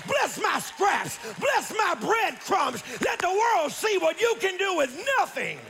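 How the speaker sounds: high-pitched shouted revival cry; full-force preaching. Real, not acted. Preacher crying to God to bless the remnant, full-force preaching. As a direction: cry out each plea in punchy bursts.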